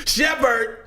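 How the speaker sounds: high, yelled attempt at German; yelled mock German. Showing how to say German Shepherd, yelled mock German. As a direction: yell it as bad German. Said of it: "you sound like you're yelling Shepherd".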